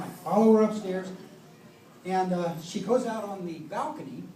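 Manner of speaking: normal talking voice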